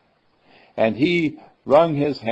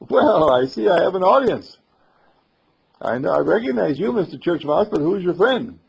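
raised, louder voice greeting an audience; hearty musician; 6 semitones higher, 6 dB louder than usual. Acted. Musician spots mouse and boy watching, hearty raised greeting. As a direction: call down heartily to the little listeners.

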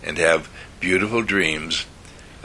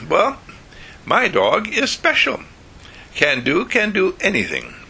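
put-on much higher boasting child voice; proud little boy; 10 semitones higher than usual. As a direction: brag like a five-year-old. Freddie boasts about his dog.

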